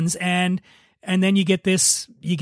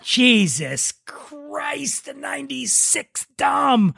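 very slow, higher, horrified groan; mock horror; 7 semitones higher, much slower than usual. Host discovering the opened ninety-six Dom, mock horror. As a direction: drag it out in disbelief.

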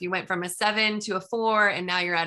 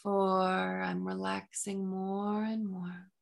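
soft, slow hypnotic induction; slow hypnotist voice; 7 dB quieter, slower than usual. Acted. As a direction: lull them down gently and slowly. Hypnotic countdown continues.

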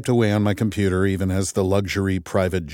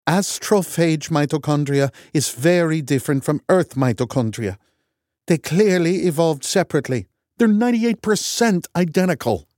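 scornful Norwegian; 8 semitones higher than usual. A narrator's reading. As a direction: sneer it in a Norwegian accent. Loken arguing.

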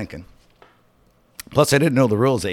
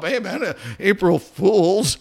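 slow, higher, sheepish April Fools; sheepishly owning up to the prank, drawn out; 7 semitones higher, slower than usual. Retold or imitated. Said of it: "All sheepishly".

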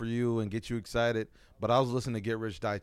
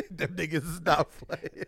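laughing; 5 semitones higher than usual. Real, not acted. Laughs while talking.

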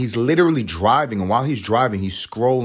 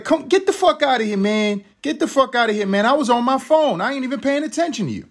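high, dismissive mock voice of the careless driver; mocking the other driver. Retelling the careless driver's brush-off, high and dismissive. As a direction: sneer it back like a jerk brushing you off. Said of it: "He's like".

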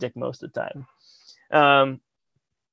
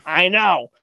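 raised, heated retort; exasperated outburst; 5 semitones higher, 4 dB louder than usual. Retold or imitated. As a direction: vent loudly, acting out the frustration. Retold outburst at bandmate's calming.

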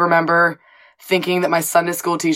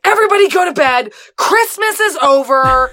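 much higher, louder imitated yell; angry dad; 10 semitones higher, 5 dB louder than usual. Dad ending Christmas Eve after a fight, imitated yell. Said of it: "And he's all pissed off".

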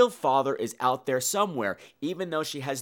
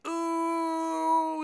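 high gleeful ooh; delighted ooh; 12 semitones higher than usual. Real, not acted. Narrator savoring coming catfights, high gleeful ooh. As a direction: squeal it with gossipy delight. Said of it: "And I'm like".

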